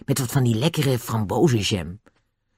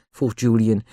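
plain reading voice

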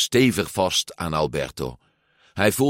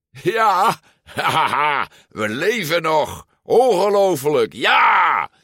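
high, jubilant shouting with laughter; roaring; 9 semitones higher, 5 dB louder, slower than usual. A narrator's reading. Luca cheering after surviving the fall, roaring. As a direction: bellow in triumphant disbelief. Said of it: "brulde Luca".

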